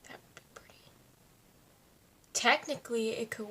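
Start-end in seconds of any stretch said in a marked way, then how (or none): 0.0-0.9 whisper. Real, not acted. Whispered aside while doing makeup.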